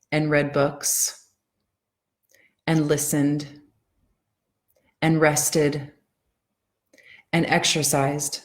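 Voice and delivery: each line read slowly with long pauses between; slow poem reading. Shown as a demonstration. Coach reading a poem to her followers, slow and present. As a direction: let every line land before the next. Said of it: "I'm gonna read it really slow".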